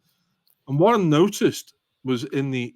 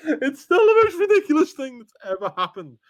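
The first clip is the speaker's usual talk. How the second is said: high and louder, laughing through the words; laughing disbelief; 5 dB louder than usual. Real, not acted. Recalling the Glastonbury offer, laughing in disbelief, high and louder. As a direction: gasp it out, still amazed.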